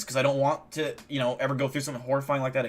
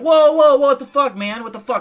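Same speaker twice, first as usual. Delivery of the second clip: much higher, much louder panicked yelling; mock scream; 11 semitones higher, 11 dB louder than usual. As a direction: scream like you're being stabbed. Voicing stabbed abductee.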